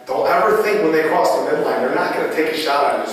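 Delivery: normal talking voice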